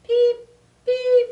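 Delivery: very high, loud chirps; loud frog peeps. Acted. Frog peeps at the top of his lungs, very high. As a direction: peep at the top of your lungs.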